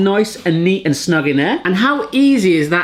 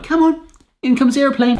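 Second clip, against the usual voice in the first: put-on mum feeding a baby; 9 semitones higher than usual. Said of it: "how the mum ... was like".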